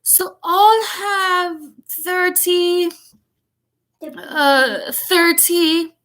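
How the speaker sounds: put-on high, very slow dim boy; slow-witted British child, high and halting; slow, laboured thinking. A narrator's reading. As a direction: drag each word out as if counting is hard. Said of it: "Finally, he said slowly".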